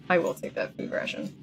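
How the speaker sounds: under-the-breath whisper; whispered aside. Real, not acted. Streamer muttering to herself over the game, whispering. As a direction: whisper it to yourself.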